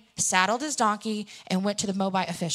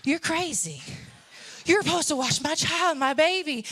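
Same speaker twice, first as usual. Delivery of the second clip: imitating an outraged mom, raised pitch; indignant mother; 4 semitones higher than usual. Mother berates dad for not watching baby, indignant. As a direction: protective mother's mock scolding. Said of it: "she's probably thinking".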